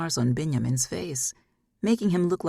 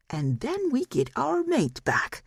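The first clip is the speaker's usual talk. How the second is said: much higher, slow scheming glee; a slow high gloat rubbing his paws; 10 semitones higher, slower than usual. Acted. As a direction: savour it like a villain.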